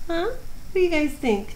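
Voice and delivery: high cooing question to pets; pet talk. Real, not acted. Asking the cats again.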